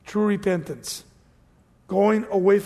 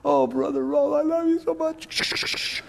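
fake flattery; 7 semitones higher than usual. Preacher mimics a fake churchgoer flattering him, syrupy high. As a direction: gush like a phony church member. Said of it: "come in front of people like that".

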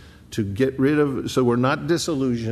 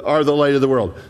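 ordinary talk, nothing marked

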